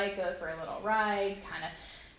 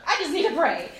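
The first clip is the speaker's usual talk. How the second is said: desperate plea; 8 semitones higher, 9 dB louder than usual. Retold or imitated. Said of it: "and I'm like".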